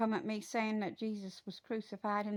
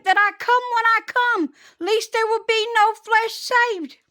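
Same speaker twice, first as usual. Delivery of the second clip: loud, high hellfire sermon; full-force preaching; 11 semitones higher, 13 dB louder than usual. Real, not acted. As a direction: thunder the warning at the congregation.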